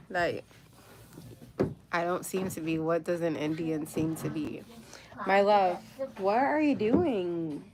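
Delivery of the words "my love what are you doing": louder, slow call across the room; calling to daughter. Real, not acted. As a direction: mom calling her daughter, drawn out. Calls out to her daughter.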